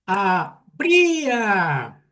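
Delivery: "habría" stretched very slowly, syllable by syllable; teacher spelling out "habría" slowly by syllables; slow syllable demo. Shown as a demonstration. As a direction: drag out "habría" so the class can count its syllables. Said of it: "¿cuántas sílabas lleva?".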